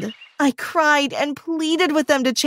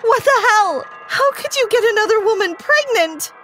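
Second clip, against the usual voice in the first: screaming; 9 semitones higher than usual. Acted. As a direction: shriek it at him in jealous rage. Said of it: "I got up and screamed".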